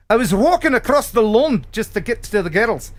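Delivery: put-on Scottish accent sliding toward Irish; terrible Scottish accent. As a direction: attempt a hammy Scottish brogue. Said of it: "my terrible Scottish accent".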